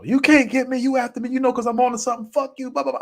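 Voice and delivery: high, loud yelling of scared defiance; loud, high mock-tough ranting at the ghost; fake bravado. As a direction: puff yourself up while terrified. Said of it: "social bravado".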